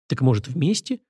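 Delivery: tentative, hopeful question; cautious suggestion. A narrator's reading. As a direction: offer it carefully, testing the water. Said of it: "осторожно предложила женщина". (осторожно, неуверенно)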